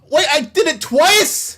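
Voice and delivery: loud high disbelief, drawn out; shocked. Real, not acted. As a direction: shout in stunned disbelief. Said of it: "shocked at his repeated mistake".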